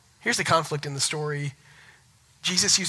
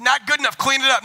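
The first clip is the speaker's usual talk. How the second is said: high, loud fake-stern order; mock harsh dad; 9 semitones higher, 8 dB louder than usual. Joking dad ordering his daughter to clean up, mock harsh. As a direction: bark it as a joke tyrant. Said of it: "No, I'm kidding".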